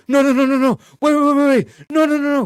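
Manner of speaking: high, loud frantic begging; panicked pleading. Pretend. Man tied up in the chair begging, panicked high pleading. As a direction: beg for your life while tied to a chair. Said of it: "the dude that's actually tied up in the chair".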